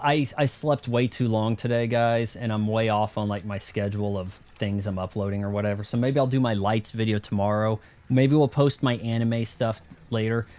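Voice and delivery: normal talking voice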